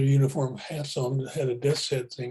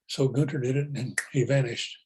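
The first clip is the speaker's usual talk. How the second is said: ordinary talk, nothing marked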